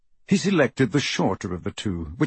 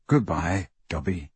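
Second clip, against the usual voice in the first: understated sorrowful goodbye; subdued farewell; 5 semitones lower than usual. A narrator's reading. Harry's choked farewell at grave.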